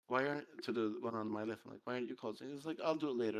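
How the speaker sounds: ordinary delivery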